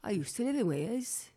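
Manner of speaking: sing-song put-on Welsh; Welsh accent. Host recalling living in Wales, in a Welsh accent. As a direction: keep up the Welsh lilt. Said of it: "That's a good Welsh".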